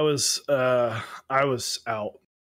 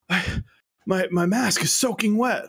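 higher-pitched mock panic of a kid; imitated freak-out; 8 semitones higher, 3 dB louder than usual. Pupil reporting a soaked mask to teacher, mock freaked out. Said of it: "he's freaking out".

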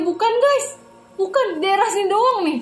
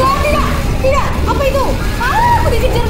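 panicked yelling; 10 semitones higher, 5 dB louder, faster than usual. Real, not acted. Chased by creature.

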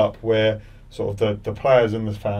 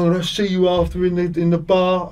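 higher, louder pub-threat voice; mock threat; 8 semitones higher, 6 dB louder than usual. Heckling fan threatening the player after the match, high mock threat. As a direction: throw down a mock challenge.

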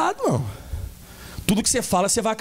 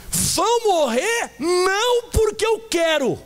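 higher and slow, stressing eu; god's voice; 6 semitones higher, much slower than usual. Acted. Pastor voicing God to Moses, high and slow. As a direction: divine voice drawing out each word.

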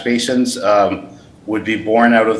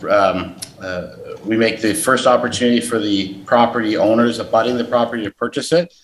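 normal talking voice

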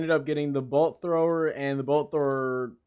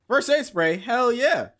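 excited; 8 semitones higher, 4 dB louder, slower than usual. Real, not acted. Excited to find spray.

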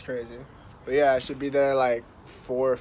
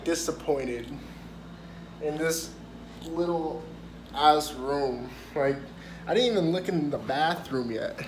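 normal talking voice

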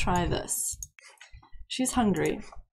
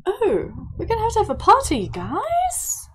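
high, gushing party excitement; excited announcement; 9 semitones higher, 4 dB louder than usual. Real, not acted. Player telling viewers about tomorrow's birthday party, excited. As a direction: burst out with high-pitched glee.

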